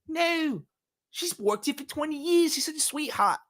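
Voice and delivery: high, mock Australian character voice; Australian accent. Foster worker vouching for a colleague, high mock Australian accent. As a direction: gush defensively in a put-on Australian accent. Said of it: "That's my Australian".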